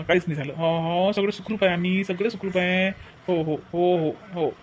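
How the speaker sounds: calm a worried sister gently; reassuring. Acted. Brother reassuring sister on phone. (बहिणीला हळू आवाजात धीर देणारा भाऊ)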